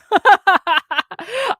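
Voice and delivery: loud, high burst of laughter. Real, not acted. Streamer teasing a regular viewer, loud high laugh.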